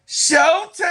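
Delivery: high, loud Showtime call breaking into a laugh; hype shout. Real, not acted. Hyping the Lynx guard to viewers, loud shout. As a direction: shout it like an arena announcer.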